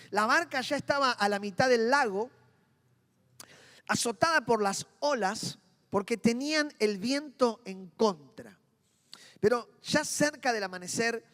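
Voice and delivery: dramatic scripture reading. Acted. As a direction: read the passage with weight. Reads Mateo 14 aloud.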